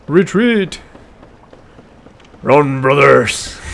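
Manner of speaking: loud, slow battle cry of a soldier; Space Marine voice. Acted. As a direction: bellow orders to your squad. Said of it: "That's my Space Marine voice".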